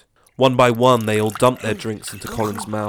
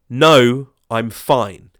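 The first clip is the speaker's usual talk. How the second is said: louder curt refusal; shouted; 2 semitones higher, 10 dB louder, much slower than usual. Acted. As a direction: shout down a short no. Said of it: "he shouted".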